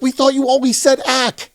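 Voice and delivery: high-pitched exasperated defence; hammed-up, high protest; mock protesting writers. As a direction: plead bafflement in a high voice. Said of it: "And they're like".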